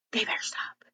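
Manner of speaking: whispered threat under the breath. Shown as a demonstration. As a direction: hiss it quietly to yourself. Muttering aside about siblings.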